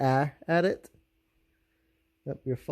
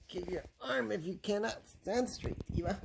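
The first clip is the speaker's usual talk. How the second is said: soft high coaxing; 7 semitones higher, 8 dB quieter than usual. Real, not acted. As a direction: coax her softly in a high voice. Softly coaxing baby to stand.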